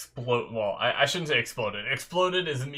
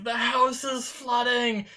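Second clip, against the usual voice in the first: high-pitched, drawn-out mock yell; mock panicked yell, stretched out without much volume; mom yelling; 12 semitones higher, slower than usual. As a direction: imitate mom's alarmed cry, voice jumping way up. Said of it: "I hear my mom yelling".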